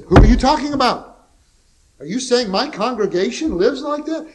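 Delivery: high, loud indignant outburst; angry retort. Retold or imitated. Old Baptist minister snaps at his critic, loud and indignant. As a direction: bristle and fire back at the accuser. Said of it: "The old Baptist minister got mad".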